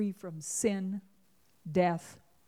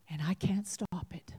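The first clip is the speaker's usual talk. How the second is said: hushed whisper of loss; 3 semitones lower, 7 dB quieter than usual. Acted. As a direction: whisper it, helpless. Precious thing dying.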